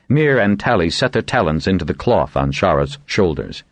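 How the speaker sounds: ordinary delivery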